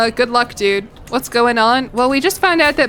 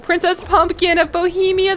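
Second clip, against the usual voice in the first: cooing delight; 7 semitones higher than usual. Real, not acted. Naming the baby daughter.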